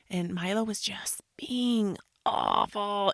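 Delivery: a hushed, exasperated whisper; whispered complaint. Real, not acted. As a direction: whisper it slowly. Complaining about her son.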